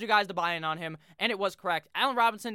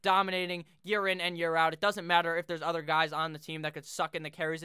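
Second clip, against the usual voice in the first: normal talking voice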